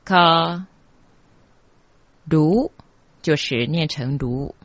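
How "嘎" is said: "嘎" as an open ga, for 加; pronounced ga. Shown as a demonstration. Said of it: "这个加字念成".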